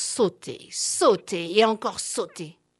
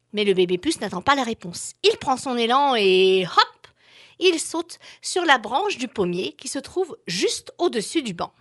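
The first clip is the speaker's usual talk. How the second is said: plain reading voice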